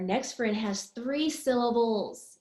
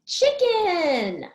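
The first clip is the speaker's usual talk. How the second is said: high, falling call of CHICKEN; word called out whole; 6 semitones higher, 5 dB louder than usual. Shown as a demonstration. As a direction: announce CHICKEN in one high, falling sweep. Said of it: "How many syllables?".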